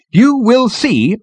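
high, loud, slow insistence; firm. A narrator's reading. Girl informs Ford of agenda, firmly. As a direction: spell it out firmly. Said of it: "continued the girl firmly".

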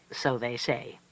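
plain reading voice

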